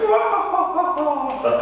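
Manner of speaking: very high, drawn-out "wah"; high mock roar of excited fans; imitated crowd roar. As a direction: mimic a crowd erupting. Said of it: "The crowd was like".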